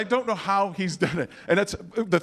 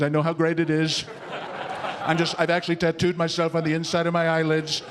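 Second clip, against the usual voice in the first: Donald Trump; 4 semitones lower than usual. Retold or imitated. Comic playing the candidate promising to make everything great, boastful impression. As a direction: boast steadily in the politician's cadence. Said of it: "I've always loved watching Donald Trump".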